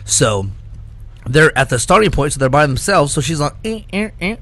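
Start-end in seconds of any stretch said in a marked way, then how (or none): 3.6-4.4 mock robot beeps, softer and higher; robot noises. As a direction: imitate a clunky dancing robot. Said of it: "doing the robot".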